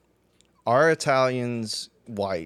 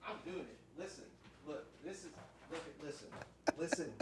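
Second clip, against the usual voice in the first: far off mic; 21 dB quieter than usual. Real, not acted. Walks off to fetch seasonings.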